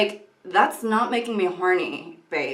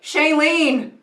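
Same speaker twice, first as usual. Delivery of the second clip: mock call-out; 6 semitones higher, 8 dB louder, much slower than usual. Calling out to actress.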